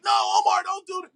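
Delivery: high, loud mock warning; mock alarmed shout. Inner voice warning him off a mistake, mock alarmed shout. Acting out audience calling at screen.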